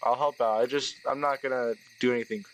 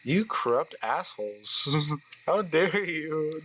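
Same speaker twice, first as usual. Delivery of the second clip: mock outrage; 3 semitones higher, much slower than usual. Mock outrage at other players.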